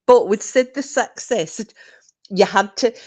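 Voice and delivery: ordinary talk, nothing marked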